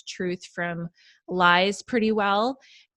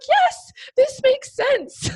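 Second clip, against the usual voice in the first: delighted realization; 5 dB louder, much slower than usual. Retold or imitated. Her own reaction, retold.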